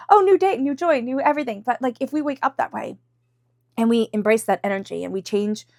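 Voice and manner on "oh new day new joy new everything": bright, much higher sing-song mock-naive optimism; naive mock cheer. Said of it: "It can sound a bit stupid to say".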